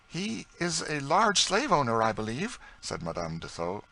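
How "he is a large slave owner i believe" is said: betraying interest. A narrator's reading. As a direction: ask carefully, high-pitched, with too much interest. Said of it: "seemed to betray more interest".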